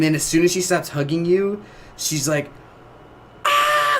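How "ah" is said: high-pitched scream at moderate volume; mock scream. Game retold as she stops hugging you, mock scream. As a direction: imitate a sudden high scream. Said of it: "she, like, screams".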